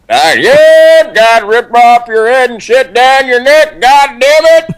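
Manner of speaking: rip into him like a drill sergeant; drill sergeant impression. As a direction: drill sergeant bellowing a threat. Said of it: "Yeah, that's the".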